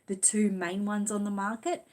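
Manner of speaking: ordinary talk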